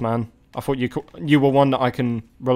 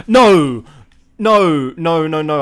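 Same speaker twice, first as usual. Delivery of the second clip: loud furious shouts of no; angry shout; 9 dB louder, slower than usual. Real, not acted. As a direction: yell it at the screen in rage. Opponent scores, real rage.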